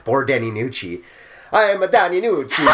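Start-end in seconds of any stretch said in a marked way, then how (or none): 1.5-2.6 hammed-up Italian accent, much higher and louder; over-the-top mock Italian accent. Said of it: "I can't do an Irish accent".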